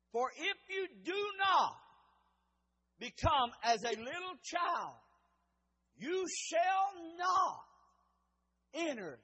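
forceful, drawn-out scripture warning; emphatic preaching. Real, not acted. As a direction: cry out the warning phrase by phrase.

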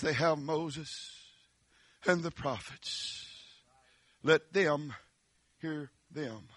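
Father Abraham's calm reply. Acted. Abraham quietly answering the rich man. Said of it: "And he says".

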